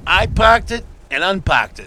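put-on slow, punched Boston accent. Co-host quoting a commercial, slow broad Boston accent. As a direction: lay Boston on slowly. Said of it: "everybody has a Boston ac".

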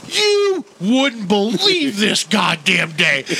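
slow, hammed-up exasperated rant; retold exasperation. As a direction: imitate a fed-up friend, dragging out each word. Retelling friend's exasperated outburst.

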